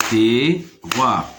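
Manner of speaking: "Terroir" split in two, te-rroir, first part rising; syllable by syllable. Shown as a demonstration. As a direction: pause between the two beats of "Terroir". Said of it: "se compose de deux syllabes". (« Terroir » découpé en deux : te… rroir)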